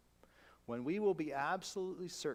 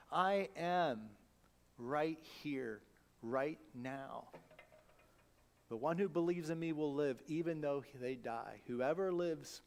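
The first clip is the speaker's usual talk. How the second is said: scripture reading; 2 semitones lower than usual. Acted. Reads scripture aloud.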